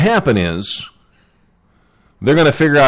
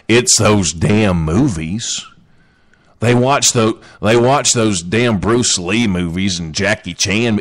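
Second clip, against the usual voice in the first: mock Southern accent; 5 semitones lower than usual. Griping about kung fu movies, mock Southern accent. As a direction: put on a Southern accent, pitched down. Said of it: "why I'm using that accent".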